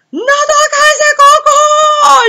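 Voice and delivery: high, loud panicked shriek in Spanish; frantic mother yelling at her kid; mom screaming. Retold or imitated. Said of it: "I heard her screaming".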